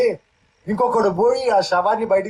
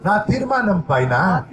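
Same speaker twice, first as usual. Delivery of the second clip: preacher leading prayer aloud, phrase by phrase; fervent prayer; 2 semitones higher than usual. Acted. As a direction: pour it out to God with the church. Leads congregation in prayer. (ప్రార్థనలో ఉత్సాహంగా)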